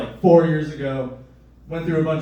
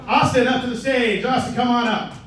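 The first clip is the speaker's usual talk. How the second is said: raised, higher-pitched host's call; stage introduction; 7 semitones higher than usual. Real, not acted. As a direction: bring the act on, calling out. Host calls act to stage.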